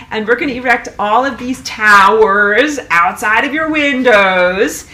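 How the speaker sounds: mock the authorities while furious; real anger. Real, not acted. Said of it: "I'm very mad".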